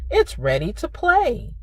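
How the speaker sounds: ordinary delivery, nothing marked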